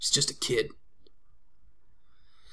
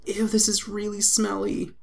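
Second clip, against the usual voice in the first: put-on much higher child voice, slow; little girl voice; slower than usual. Child Mai coughing after sniffing a jar, high girl voice. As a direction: play the kid, whiny and high.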